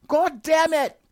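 very high, loud enraged cry; furious shout. Acted. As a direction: explode in rage. Crapped on, outburst.

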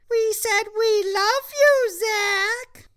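sweet gremlin voice. Acted. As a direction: fake innocence like cute critters. Mogwais feign innocence.

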